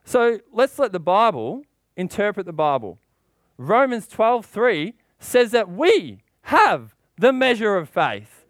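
ordinary delivery

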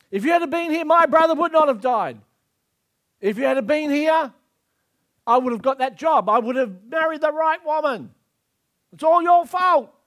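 loud, high accusing outburst; hammed-up bitter tirade; acted blaming. Voices blame thrown at God.

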